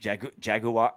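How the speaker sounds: "Jaguar" tried as jag-you-ar; tentative British "jag-you-ar" for "Jaguar". Retold or imitated. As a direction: copy the British three-syllable "Jaguar". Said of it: "the British say it".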